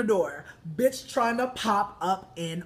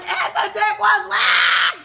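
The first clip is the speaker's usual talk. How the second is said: high, loud, drawn-out shriek; screaming echo; 12 semitones higher, 10 dB louder, slower than usual. Retold or imitated. Speaker echoes the girlfriend's complaint from the car, screaming. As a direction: scream it, punching every word.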